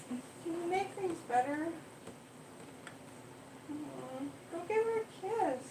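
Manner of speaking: high, soft, soothing pet voice; comforting the cat. Real, not acted. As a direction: coo gently to calm a scared kitty.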